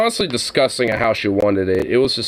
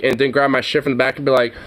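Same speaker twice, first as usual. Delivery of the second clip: usual speaking voice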